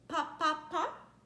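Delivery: usual speaking voice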